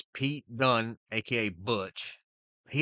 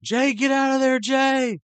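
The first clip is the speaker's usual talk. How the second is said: high, loud shouted warning; imitating a yell; 8 dB louder than usual. Commentator Cole yells at Jay to flee, high loud shout. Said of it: "Cole was yelling".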